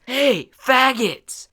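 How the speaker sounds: high, loud, drawn-out jeer; taunting call-out. Retold or imitated. Friend taunts the other group across the walkway, imitated shout.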